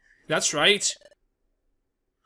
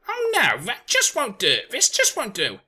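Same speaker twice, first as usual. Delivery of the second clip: starts much higher, then tuts; fussy fretting; 7 semitones higher, 4 dB louder than usual. Acted. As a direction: fret like a prim bird. Fussy bird panics.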